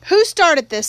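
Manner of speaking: stern demand. A narrator's reading. Uncle demands after the fight.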